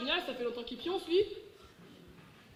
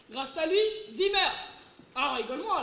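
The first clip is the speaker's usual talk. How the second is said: usual speaking voice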